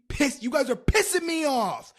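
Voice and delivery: high, very loud outburst; mock angry shout. Storyteller scolding her listeners, mock furious shout. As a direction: suddenly yell in exaggerated fury.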